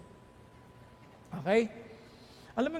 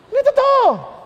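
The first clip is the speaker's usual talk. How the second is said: sudden high loud denial; loud outburst; 10 semitones higher, 11 dB louder than usual. Retold or imitated. Preacher mocking his old self, loud burst.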